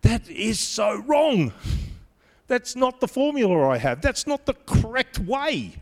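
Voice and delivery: real exasperated insistence; emphatic protest. Real, not acted. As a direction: press hard on how wrong it is. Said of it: "in my traditional understanding".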